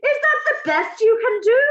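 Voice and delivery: the Grand High Witch berating her audience, yelling in fury; furious witch yelling. A narrator's reading. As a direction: scold the witches like vermin.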